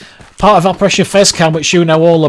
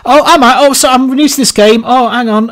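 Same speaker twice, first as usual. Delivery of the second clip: clueless newbie voice; 9 semitones higher, 4 dB louder than usual. Retold or imitated. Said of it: "I'd do different characters and different voices".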